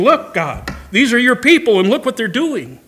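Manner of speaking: a bold, almost indignant prophet; Habakkuk's bold complaint. Retold or imitated. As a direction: prophet challenging God. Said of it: "He's saying".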